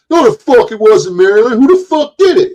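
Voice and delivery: high, loud tough-guy snarl; getting all tough. Retold or imitated. [name] getting tough with the crowd, loud angry demand. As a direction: puff up and demand an answer. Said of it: "he was getting all tough".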